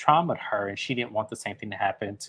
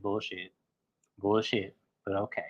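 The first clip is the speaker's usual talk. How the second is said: much softer, dry disbelief; sarcastic aside; 4 semitones lower, 8 dB quieter than usual. Retold or imitated. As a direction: say it under your breath, unconvinced. Host's muttered sarcastic aside.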